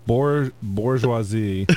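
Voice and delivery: drawn-out stumble on "bourgeoisie"; slow careful guess. Real, not acted. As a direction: sound it out haltingly. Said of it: "That would be bourgeois".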